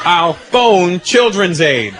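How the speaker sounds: very slow, deliberate "phone"; understated brave kid; kid's threat again. Retold or imitated. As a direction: lean on the bluff slowly.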